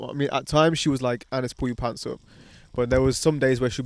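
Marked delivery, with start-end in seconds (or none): none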